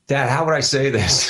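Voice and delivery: a hint of his daughter asking for help; imitating daughter. Daughter calling dad about her accent, lightly imitated. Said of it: "she kept calling me saying".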